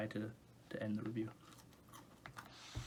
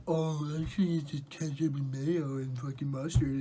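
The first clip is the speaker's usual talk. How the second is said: louder, higher snooty know-it-all voice; mocking know-it-all; 3 semitones higher, 6 dB louder than usual. Vlogger mocking a commenter, sneering know-it-all voice. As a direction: mimic a smug critic.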